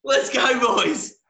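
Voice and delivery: high, loud superfan battle cry; fired-up fan roaring the group into action; rowdy hype shout. Acted. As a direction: burst in yelling to rally the boys. Superfan bursts into the chat.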